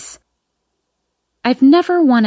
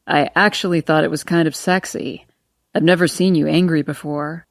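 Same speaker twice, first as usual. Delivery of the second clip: put-on lower, amused young man; lower male voice; 4 semitones lower than usual.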